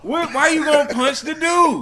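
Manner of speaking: shouted, disbelieving question; loud challenge. Real, not acted. Arguing with his cohost over punching the dude, shouting. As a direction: call him out loud and high.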